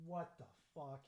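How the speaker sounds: normal talking voice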